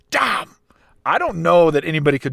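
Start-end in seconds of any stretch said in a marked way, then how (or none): 0.1-0.5 sharp high exclamation; exclaiming damn. Retold or imitated. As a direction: blurt it out. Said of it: "he slams his cane down and he's like".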